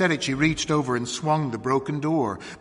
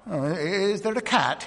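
higher, hesitant distracted question; absent-minded; 7 semitones higher than usual. A narrator's reading. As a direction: ask while thinking of something else. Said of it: "he said absently".

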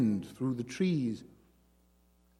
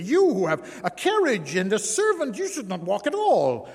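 indignant; 9 semitones higher, 5 dB louder than usual. Acted. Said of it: "asked angrily".

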